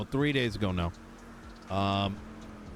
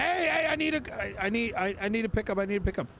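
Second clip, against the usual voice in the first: retold screaming; 8 semitones higher than usual. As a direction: imitate a frantic guy begging for a pickup. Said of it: "and he's screaming".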